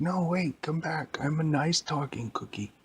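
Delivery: high, pleading little cookie voice; pleading Shrek cookie. Acted. Shrek cookie calls back fleeing scientist, pleading. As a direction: plead like a harmless little cartoon character. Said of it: "And the Shrek cookie said".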